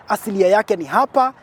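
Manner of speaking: usual speaking voice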